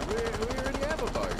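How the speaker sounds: high-pitched female-character quote; high mock heroine voice; Elena. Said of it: "And Elena goes".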